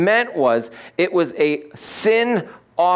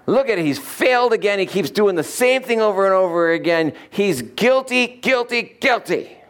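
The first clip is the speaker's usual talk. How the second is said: higher-pitched accusing devil; 6 semitones higher than usual. Acted. Devil accusing a believer before the righteous judge, insistent devil voice. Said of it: "This is my devil voice".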